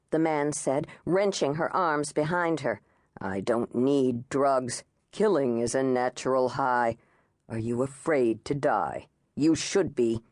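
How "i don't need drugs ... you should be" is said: menacing intruder. A narrator's reading.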